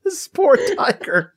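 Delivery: high, loud shocked squeal; horrified high-pitched gasp of disbelief. Retold or imitated. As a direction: squeal it out, aghast at the poor tiger. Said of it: "Leah and I are like".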